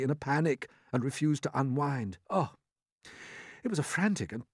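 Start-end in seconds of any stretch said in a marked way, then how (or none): none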